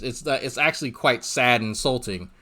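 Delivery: ordinary talk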